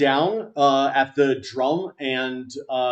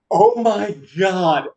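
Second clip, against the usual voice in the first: slow, higher, stunned exclamation; acted astonishment; 4 semitones higher, 4 dB louder, slower than usual. Game master voices man looking up at his rescuer, slow astonishment. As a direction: draw it out in stunned awe. Startled character looks up at rescuer.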